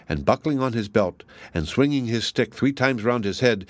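usual speaking voice

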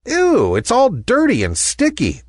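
high-pitched, squeamish disgust. A narrator's reading. Ballerina rejecting the grubby toy, disgusted.

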